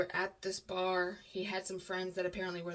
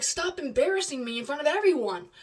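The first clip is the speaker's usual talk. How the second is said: loud, higher angry scolding; mad; 6 semitones higher, 9 dB louder than usual. Retold or imitated. Imitating partner telling her to stop embarrassing him, mad. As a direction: scold them, voice raised in annoyance. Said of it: "he got mad. He's like".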